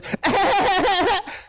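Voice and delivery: put-on high, stuttering toddler wail; imitated child's cry. Dad imitating daughter after scraped knee, stuttering mock cry. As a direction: mimic a hurt kid winding up to cry. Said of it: "there's a type of cry".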